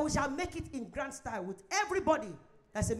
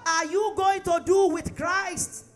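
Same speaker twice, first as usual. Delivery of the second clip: louder emphatic question; 6 semitones higher, 8 dB louder, slower than usual. Shown as a demonstration. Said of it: "repeated question".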